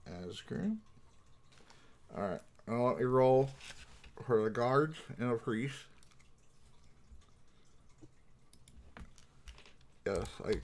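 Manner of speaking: talking around a pen in the mouth; words muffled by a pen held in teeth. Real, not acted. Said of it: "I do have a pen in my mouth".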